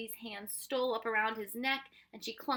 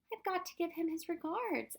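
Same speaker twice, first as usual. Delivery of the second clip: put-on high little-girl plea; tearful; 10 semitones higher than usual. A narrator's reading. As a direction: plead on the edge of tears, pitch raised. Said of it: "added Win-, Lindy tearfully".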